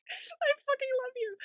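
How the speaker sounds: very high, gushing little voice; adoring squeal. Retold or imitated. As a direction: coo it high, besotted with a silly creature. Gushing over a clumsy dinosaur.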